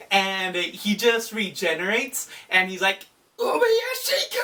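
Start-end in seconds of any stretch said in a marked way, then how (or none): 3.4-4.4 much higher, strained scream; furious scream of a name. Retold or imitated. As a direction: scream the enemy's name in rage. Muzan rages after regenerating.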